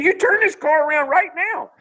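a laughing, squealing wife taking charge; excited wife. Retold or imitated. Wife ordering him to turn the car around, high and laughing. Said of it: "she got all excited".

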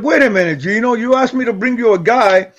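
high, excited protest at the promoter; retold indignant comeback. Telling Gino off about the guy he brought, pitched high and heated. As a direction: fire back, worked up and pitched high.

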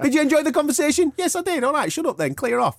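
very high-pitched mocking back-and-forth; mock exchange. Host sending up two mates' conversation, high mock exchange. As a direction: squeak it out as a send-up. Mocks the chat he interrupted.

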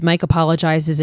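normal talking voice

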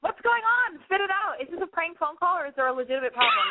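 host nudging a stalling caller to spit it out, impatient; impatient prodding. Real, not acted. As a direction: hurry him along, half-exasperated. Host pushing a hesitant caller.

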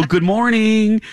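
high, sing-out friendly greeting; real nice. Retold or imitated. Customer trying to win over a barista, real nice. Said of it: "trying to be real nice to him".

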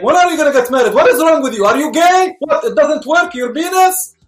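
loud, high mock parental scolding; imitating nagging parents. Parents demanding to know why he isn't married, imitated loud nagging.

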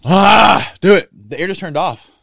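loud, high, fired-up outburst; buzzing from the salts, loud and high; excited shout. Real, not acted. Hit by smelling salts.

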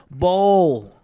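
high, loud mock yelling; imitated screaming. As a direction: yell it slowly, mimicking someone ordering food. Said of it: "You were screaming at this one lady".

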